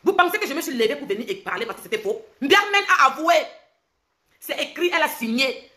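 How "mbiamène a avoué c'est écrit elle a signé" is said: high, loud declaration; loud accusation. Real, not acted. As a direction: proclaim the confession loudly. Heated accusation in a rant.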